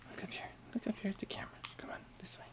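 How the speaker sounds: soft coaxing whisper. Real, not acted. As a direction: whisper gently, coaxing him to look. Coaxing baby toward the camera.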